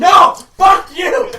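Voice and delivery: loud joking yell; mock angry shout. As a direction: scream it in fake rage. Mock outburst at friend.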